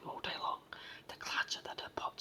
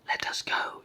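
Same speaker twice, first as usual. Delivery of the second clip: raised voice, a small captive's yell; loud yell; 8 dB louder than usual. A narrator's reading. Tiny brownie demands release from the giant, yelling. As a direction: call out loudly to be let go. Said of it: "yelled Hop in his loudest voice".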